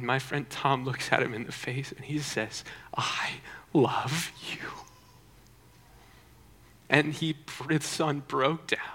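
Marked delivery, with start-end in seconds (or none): 3.0-4.7 slow, breath-only "I love you"; retold father's hushed, deliberate reply; whispered declaration. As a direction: answer hatred with a slow, intense whisper. Father answers the boy's hatred.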